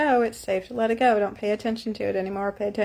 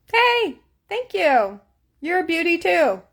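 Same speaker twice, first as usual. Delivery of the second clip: delighted greeting; 7 semitones higher than usual. Real, not acted.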